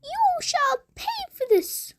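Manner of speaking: high witch voice. A narrator's reading. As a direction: threaten like a witch. Witch threatening carpenter.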